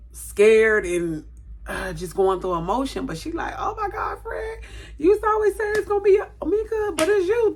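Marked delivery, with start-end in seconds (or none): 3.6-7.5 high, loud, giddy teasing; the friend shrieks with laughing delight; excited laughing shout. Retold or imitated. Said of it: "And she laughing".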